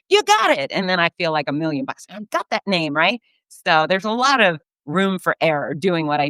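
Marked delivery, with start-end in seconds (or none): 0.1-0.7 high, louder cheer; imitated yell. Athletes cheer at the announcer, high and loud. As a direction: call it out with delight. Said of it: "they yell out".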